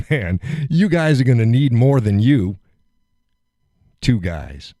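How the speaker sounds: mocking laugh-taunt at the guards. Man laughing a taunt at two security guards. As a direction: chuckle the dare at them. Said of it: "I laughed".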